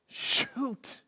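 hushed whispered urging. Real, not acted. Preacher whispering to the congregation to grab life. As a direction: whisper it close to the listener.

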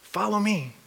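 soft, quiet urging; gentle God voice. Retold or imitated. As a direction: coax them gently forward. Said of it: "And he's going".

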